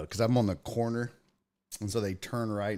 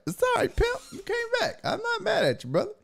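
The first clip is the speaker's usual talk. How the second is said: high reassuring; faster than usual. Retold or imitated. Reassuring the trash man.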